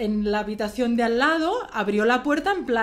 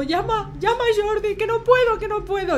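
high and louder, urgent; pleading through tears; 11 semitones higher, 5 dB louder than usual. Retold or imitated. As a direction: beg the boss to make the call. (súplica urgente y aguda, sin poder hablar)

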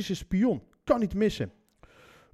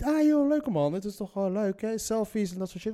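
mock enthusiasm; 2 semitones higher than usual. Mocking the selfie-happy traveller in a war zone, mock enthusiasm. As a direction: play the thrilled war tourist, hammed-up.

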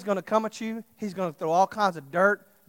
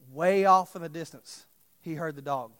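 ordinary talk

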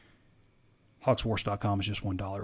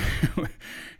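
short unvoiced chuckle; breathy laugh; 5 semitones higher than usual. Real, not acted. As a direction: laugh it off quickly. Laughs before finishing question.

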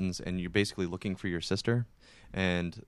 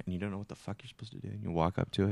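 usual speaking voice

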